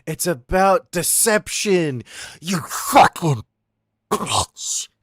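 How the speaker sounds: slow, loud venting; frustrated outburst. Real, not acted. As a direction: let it all out at once.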